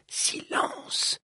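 insistent whisper in the ear; hushed order to be silent. A narrator's reading. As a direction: whisper it, drawn out and firm. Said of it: "chuchoter à l'oreille".

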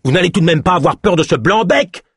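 scornful outburst at his own men; raging. Acted. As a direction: berate the cowardly henchmen. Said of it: "vitupéra Ézoc".

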